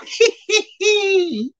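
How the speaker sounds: very high, squealing cackle; Crypt Keeper cackle. Acted. Host mimics the show's puppet host, high cackle. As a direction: cackle in a thin falsetto squeal.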